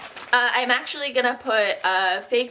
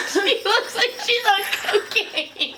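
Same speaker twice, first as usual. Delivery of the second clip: high laughing; giggling while talking; 11 semitones higher, slower than usual. Real, not acted. Laughing at friend's face.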